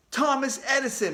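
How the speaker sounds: high, loud eager answer; shouted answer. A narrator's reading. Boy shouting his answer at the quiz, high and loud. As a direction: blurt it out to beat her. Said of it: "I shouted".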